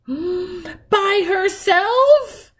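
gasp, then high, drawn-out question; shocked. A narrator's reading. Girl learning the baby is alone downstairs, gasping shock. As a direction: stretch it upward in horror.

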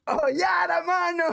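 very high-pitched, laughing mock exclamation. Streamer echoing the rapper's outburst, high laughing mimicry. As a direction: parrot the rapper in a high, amused wail. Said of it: "qué locazo se le escucha al [name] decir así".

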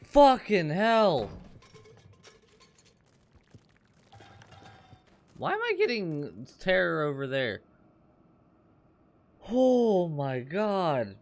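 startled high shouted swearing; scared horror game streamer. Real, not acted. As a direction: yell in real fright, drawn out.

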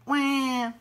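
one high, loud imitated wail; baby seal cry. A narrator's reading. Baby seal following Ebb, high wail. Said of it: "the baby seal cried back".

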